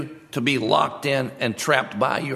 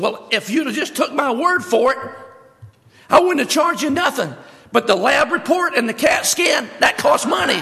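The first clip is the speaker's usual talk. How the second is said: much higher and louder, indignant comeback; shouting vet; 12 semitones higher, 5 dB louder than usual. Acted. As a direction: fire it back at her, full volume. Vet defends the bill.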